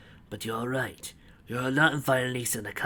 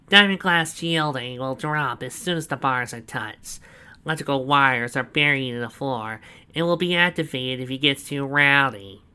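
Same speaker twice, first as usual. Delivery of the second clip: proud villain boast; 3 semitones higher, 6 dB louder than usual. A narrator's reading. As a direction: savour every word of the boast. Snively's voice.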